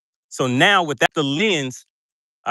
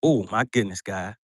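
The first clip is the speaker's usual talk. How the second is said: normal talking voice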